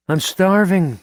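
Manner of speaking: talking through a mouthful; mouth full. A narrator's reading. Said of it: "with a mouthful of sandwich".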